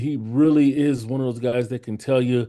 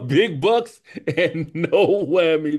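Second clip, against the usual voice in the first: laughing; 5 semitones higher, 4 dB louder, slower than usual. Real, not acted. Laughing while quoting game show.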